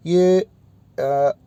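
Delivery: normal talking voice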